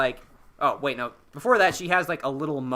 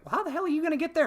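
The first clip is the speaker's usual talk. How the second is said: mock disbelief, much higher and fast; incredulous; much faster than usual. Podcaster voicing Poe doubting Zori's plan, quick and incredulous. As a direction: rattle off the question in quick disbelief. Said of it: "then Poe's like".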